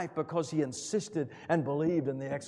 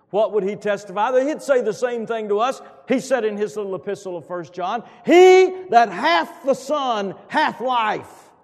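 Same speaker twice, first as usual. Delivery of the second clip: fervent scripture proclamation; preaching at full force; 10 semitones higher, 14 dB louder than usual. Acted. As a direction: lift the verse to the rafters. Proclaiming John's testimony.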